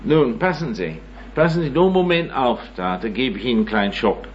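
normal talking voice

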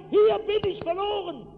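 laut und hoch hinausgeschrien; verzweifelter Schrei. Retold or imitated. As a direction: schrei um dein Leben. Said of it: "Ich konnte noch schreien". (lauter, hoher Hilfeschrei)

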